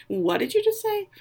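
a lightly voiced shocked lady asking back; shocked retort. Lady Danbury shocked at father.

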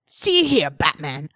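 haughty British cartoon baby; Stewie Griffin voice. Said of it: "reading it in Stewie Griffin's voice".

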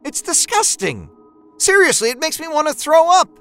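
disgusted wife. A narrator's reading. Wife tells husband his art sickens her, high disgusted. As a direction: spit out the revulsion. Wife's revulsion peaks.

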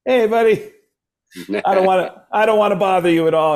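re-enacted high, loud greeting; eager hailing. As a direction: hail your idol, high, loud and apologetic. Acting own hail to Gene.

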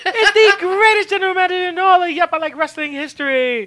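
high, loud laughing impression of the GM; impersonating co-host. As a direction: boast like the GM while laughing. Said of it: "I'll just do all your voices".

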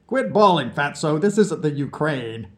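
put-on cheeky drunk from under the piano; mocking drunk. A narrator's reading. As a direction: poke fun at the crybaby. Vlad needles narrator.

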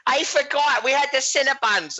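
put-on quoting her in a high girly voice; a high, breathless valley girl; high girly quote. Girl quoted in retold story.

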